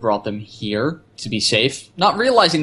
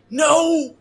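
much higher anguished no; mock cry of no. Own reaction to the shooting, dismayed. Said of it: "It's like".

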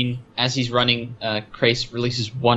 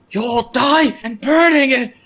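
high, slow hammed-up doom cry; manic warning; 11 semitones higher, 4 dB louder, slower than usual. Stark warning the crew, manic hammed-up doom cry. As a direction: overplay the danger wildly. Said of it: "he's going manic".

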